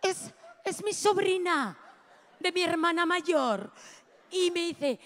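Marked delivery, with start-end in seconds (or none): 0.0-3.7 laughing. Real, not acted. Telling the crowd about her niece, laughing. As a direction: laugh your way through it.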